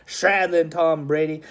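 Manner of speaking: usual speaking voice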